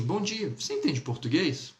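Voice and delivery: greet, then ask "você entende português?" with a rising tune; question intonation. Shown as a demonstration. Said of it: "Então eu diria assim".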